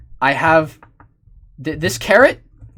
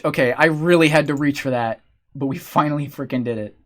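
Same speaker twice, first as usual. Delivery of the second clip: usual speaking voice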